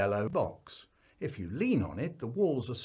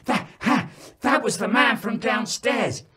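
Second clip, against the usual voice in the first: out of breath; 8 semitones higher, 7 dB louder than usual. Acted. Out of breath, telling the gigglers.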